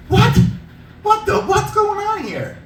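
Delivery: high, squeaky, slow disbelief; shocked he's back with a splinter again; incredulous what. Retold or imitated. Mock disbelief at the splinter guy, high and squeaky.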